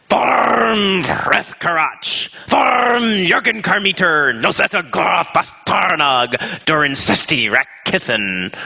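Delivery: slow, high Dwarvish accent with rolled uvular R's. Shown as a demonstration. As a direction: growl out the Dwarvish, rolling every R. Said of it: "I have an excellent Dwarvish accent".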